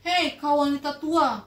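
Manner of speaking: loud, contemptuous shout; arrogant Malin. Acted. Son insulting his old mother, loud. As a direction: scorn the old woman. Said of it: "katanya gitu".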